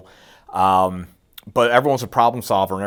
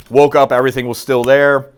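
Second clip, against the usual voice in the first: excited; 4 semitones higher, 8 dB louder than usual. Real, not acted. Relieved punchline.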